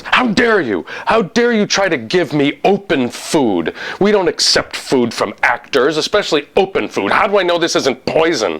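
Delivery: high-pitched, outraged telling-off; indignant casting director. Retold or imitated. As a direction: act out an affronted casting director scolding an actor. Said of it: "And she goes".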